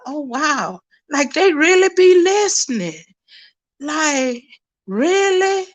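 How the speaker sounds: incredulous, pitch lifted; retold amazement. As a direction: relive being astonished. Her amazed reaction, retold.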